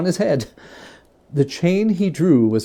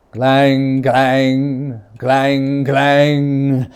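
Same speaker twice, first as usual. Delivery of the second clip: louder clanging bell; loud bell sound; 6 dB louder, much slower than usual. Acted. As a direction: ring it out loud like a great bell. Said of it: "But soon it rang out loudly".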